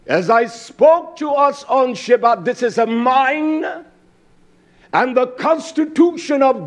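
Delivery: loud, high fiery preaching; fiery sermon delivery. Acted. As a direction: preach it hard to the congregation. Preaching at full force.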